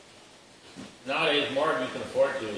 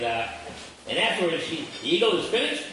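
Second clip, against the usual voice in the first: normal talking voice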